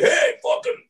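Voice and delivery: very high, mocking heckle; rowdy heckler. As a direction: jeer at the comic like a drunk crowd. Imitating rowdy bar crowd heckling.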